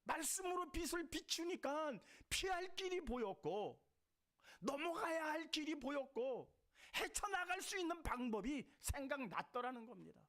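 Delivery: high, loud, ringing sermon cry; full-force preaching. Acted. Pastor to the congregation, raising the voice in fervent preaching. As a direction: preach it out to the whole hall.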